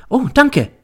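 very high, pleased thanks; polite pupil. A narrator's reading. Pupil accepting teacher's ice cream, politely pleased.

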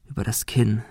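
plain reading voice

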